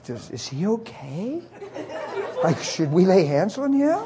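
slow, hushed worried question; tentative, half-joking murmur; mock concern. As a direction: ask softly beside a sleeper. Whispered concern about snoring roommate.

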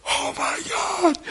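slow, high, breathless oh my God; mock-stunned woman; awed gasp. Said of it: "She turned and went".

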